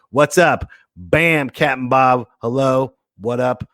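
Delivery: usual speaking voice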